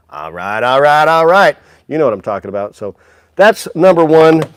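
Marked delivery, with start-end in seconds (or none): 0.1-1.6 host quoting the famous movie line, a light impression; Matthew McConaughey impression. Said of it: "Matthew McConaughey's debut with his".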